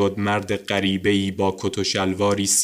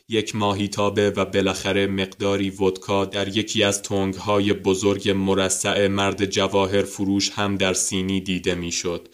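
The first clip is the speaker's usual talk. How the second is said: plain reading voice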